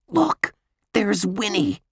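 plain reading voice

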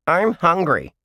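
high squeaky puppet voice; ventriloquist dummy. A narrator's reading. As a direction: play the whiny dummy. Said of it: "the man answered in a deeper voice".

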